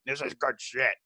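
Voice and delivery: mock boss's catchphrase impression; hammed-up impersonation of an approving boss; Vince McMahon. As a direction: put on a wrestling boss's voice. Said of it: "I don't wanna be Vince McMahon".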